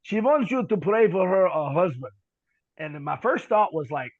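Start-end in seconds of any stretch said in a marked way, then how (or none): 0.0-2.1 put-on slow, louder, heavy Ukrainian accent; thick accent. Interpreter passing on her request, thick Ukrainian accent. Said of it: "he has a real thick accent".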